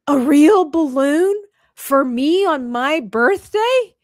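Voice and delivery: loud, high, slow wonder; mock amazement. Eeyore learns of his balloon, drawn-out mock amazement. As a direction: spell out each wonder in disbelief.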